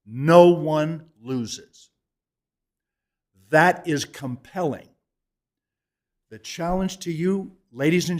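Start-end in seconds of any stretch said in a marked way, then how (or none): 0.1-1.9 "no" punched, then each word spaced out; emphasized. Real, not acted. Closing the argument to the council, slow forceful stress. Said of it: "no, emphasize".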